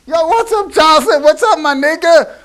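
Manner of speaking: high, loud street slang greeting to Jocelyn; slangy street greeting. Retold or imitated. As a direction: call out to a friend high and loud. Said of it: "and said".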